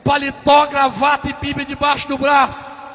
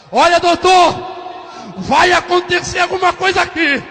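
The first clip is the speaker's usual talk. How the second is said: high, loud warning cry; urgent warning; 6 semitones higher, 4 dB louder than usual. Acted. Nurse warning the doctor something will happen, urgent loud cry. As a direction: warn him with rising urgency.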